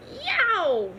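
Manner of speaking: high, loud yelp; yelp of pain. A narrator's reading. The small pig, bitten on the tail by a turtle, a high yelp.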